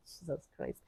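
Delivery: embarrassed whisper under breath. Real, not acted.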